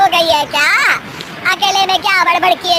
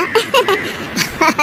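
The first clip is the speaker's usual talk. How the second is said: nervous little laugh; 3 semitones higher than usual. Acted. Nervous laugh covering lie.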